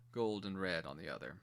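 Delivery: ordinary talk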